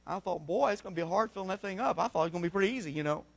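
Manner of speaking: very soft, low confiding voice; hushed aside. Retold or imitated. Preacher admitting his Coliseum dream was hard, hushed aside. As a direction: drop right down as if thinking aloud.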